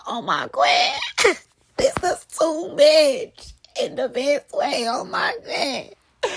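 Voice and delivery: high, loud, slow gush through a laugh; giddy, slowed-down squealing gush; overwhelmed squeal. Retold or imitated. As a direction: melt with delight, laughing. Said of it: "And I'm like".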